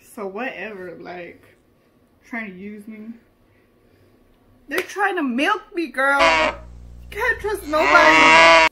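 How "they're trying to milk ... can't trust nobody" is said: crying shout. Acted. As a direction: wail it like a betrayed star. Melodramatic cry about betrayal.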